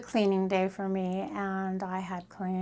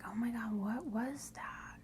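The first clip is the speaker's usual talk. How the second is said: hushed, frightened whisper, softer; scared whisper; 8 dB quieter than usual. Retold or imitated. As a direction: whisper it in the dark, afraid of an intruder. Startled by a bang at night.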